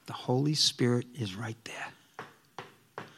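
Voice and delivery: slow hushed whisper. Real, not acted. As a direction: breathe it like a secret. Intimate point about the Spirit.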